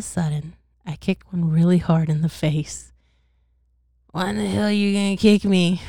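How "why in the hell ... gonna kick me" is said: loud, fast country twang; country accent. Acted. Kicked frozen head confronts the traveller, loud country accent. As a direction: play an indignant character with a country accent. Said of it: "Why is he country?".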